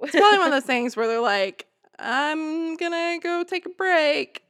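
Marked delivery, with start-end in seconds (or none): 2.0-4.4 high, slow, stagey announcement; pointedly loud announcement. Acted. Guard making sure he is overheard, pointedly loud. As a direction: announce it slowly and conspicuously, pitched up. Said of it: "say it really loudly".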